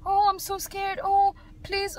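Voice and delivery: mock pleading, pitched high; acted scared child. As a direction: put on a frightened little-girl plea. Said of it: "[name] is a little actress".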